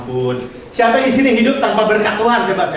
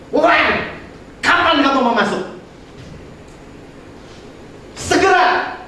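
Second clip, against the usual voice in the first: higher, pressing question and demand; urgent divine call; 5 semitones higher than usual. Pretend. Preacher voicing God's urgent call to enter. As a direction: urge them to come in right now.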